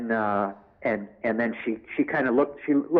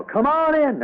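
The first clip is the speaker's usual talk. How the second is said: high, loud Southern hawker call; Southern accent, calling people in like a carnival barker; a much higher, louder Southern barker call; Southern devil barker; 5 dB louder, slower than usual. Retold or imitated. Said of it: "in a Southern accent".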